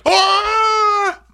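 loud, very high mock gasp-shriek; hammed-up shocked "ah"; Dorit. Castmate shocked by the bully accusation, mock shriek.

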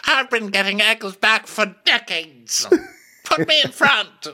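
put-on very high, slow old knight; frail old guy. Old knight begging for the front line, frail old guy. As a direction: play the eager old-timer up high. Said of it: "this just old frail guy be like".

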